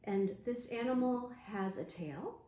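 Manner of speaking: ordinary talk, nothing marked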